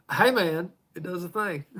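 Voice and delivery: hammed-up Southern twang on hey man; lightly put-on Southern accent. Shown as a demonstration. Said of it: "in my best Southern accent".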